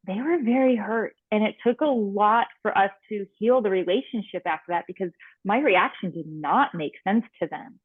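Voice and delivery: ordinary talk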